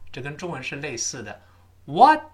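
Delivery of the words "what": "What" loud, high and rising, t swallowed; "What" stopped without a burst; unreleased final t. Shown as a demonstration. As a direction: say "What" with the last t held back. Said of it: "一定失去爆破了".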